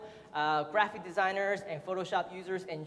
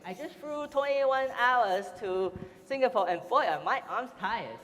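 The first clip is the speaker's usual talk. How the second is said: put-on slow, higher joke in a Chinese accent; 4 semitones higher than usual. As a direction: deliver the pun in a Chinese accent. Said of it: "this in my Chinese accent".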